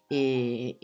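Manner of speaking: ordinary talk, nothing marked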